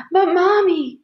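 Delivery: put-on high, tearful child's protest; little girl starting to cry; getting sobby. A narrator's reading. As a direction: whine loudly on the edge of sobs. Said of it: "starting to get all sobby".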